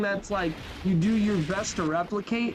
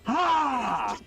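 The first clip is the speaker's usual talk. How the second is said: charging-up scream; 5 semitones higher than usual. Retold or imitated. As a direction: yell like a fighter powering up. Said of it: "Screaming, charging up".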